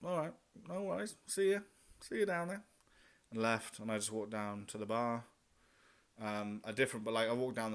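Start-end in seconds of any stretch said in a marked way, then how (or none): 0.0-2.6 forced cheery goodbye. Retold or imitated. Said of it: "So I was like".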